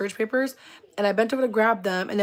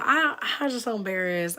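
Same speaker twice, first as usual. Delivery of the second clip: ordinary talk, nothing marked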